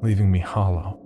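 ordinary delivery, nothing marked

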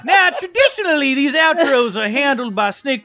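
high-pitched character hosting the sign-off; put-on high voice with bursts of laughter; theatrical outro host.

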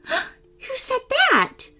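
very high, slow astonished question; gasp, then ask in a high voice; startled little girl, pitch way up. Acted. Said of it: "Jessica gasped".

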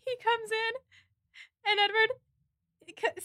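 usual speaking voice

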